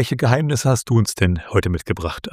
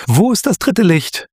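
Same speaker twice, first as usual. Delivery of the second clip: high, loud, desperate-sounding call; urgent cry; 11 semitones higher, 5 dB louder than usual. A narrator's reading. Luna calls after the vanishing figure, urgent cry. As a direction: cry out the question, high and louder. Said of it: "rief Luna abermals".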